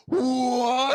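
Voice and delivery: loud, high, drawn-out cry of disbelief; real stunned shout; shocked outburst. Real, not acted. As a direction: break off and yell in astonishment. Caster stunned by a sudden play.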